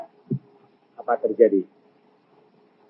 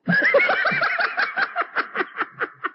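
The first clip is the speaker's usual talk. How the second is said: long, high-pitched laugh; drawn-out giggling laugh. Real, not acted. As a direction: laugh out in a high, rattling run. Preacher's own laugh mid-sermon.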